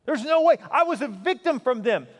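high, loud protest of a wronged victim; impassioned objection. Acted. Pastor voicing a hurt churchgoer objecting to forgiveness, in loud, high indignation.

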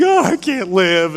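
put-on drawn-out melodramatic teen wail; mock freak-out. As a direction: mimic a kid throwing a slow, whiny fit. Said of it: "freak out and throw a fit".

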